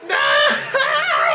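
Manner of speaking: high, drawn-out mock scream; acted falling scream. Falling jumper waving his arms, hammed-up screaming. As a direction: howl it like a man plummeting. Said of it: "he's screaming, and he's falling".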